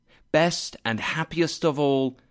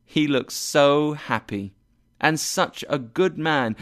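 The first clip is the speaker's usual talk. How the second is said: ordinary delivery, nothing marked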